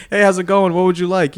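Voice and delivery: high, loud chipper server greeting; imitating an overly friendly server, high and loud; mock cheery greeting.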